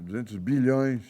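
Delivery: ordinary delivery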